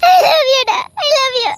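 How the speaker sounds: tender, tearful, breaking; crying confession. Acted. Husband confesses love weeping. (அழுகையுடன் காதல் சொல்லல்)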